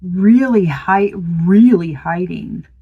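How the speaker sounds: slow down to land it; slow emphatic. Real, not acted. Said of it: "emphatic slow reveal".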